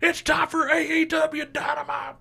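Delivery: high hyped announcer yell; announcer shout. Pretend. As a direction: hype the crowd up. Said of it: "I wish J R would open".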